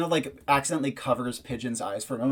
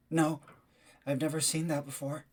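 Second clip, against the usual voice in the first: higher, hushed disbelief; wide-eyed Elkie; 3 semitones higher, 5 dB quieter than usual. Acted. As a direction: lightly voice Elkie's quiet alarm. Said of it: "then his eyes widen. He says".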